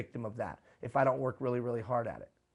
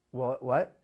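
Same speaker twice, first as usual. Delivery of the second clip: usual speaking voice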